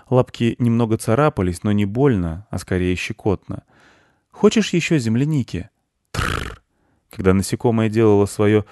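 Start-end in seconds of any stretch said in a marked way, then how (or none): 4.3-5.7 put-on high boy's question; high-pitched young boy asking gently; little boy. Boy offers strawberries. 6.1-6.6 short low rolled trill; a toneless rattle of the tongue; beetle trill. Acted. Beetle answers.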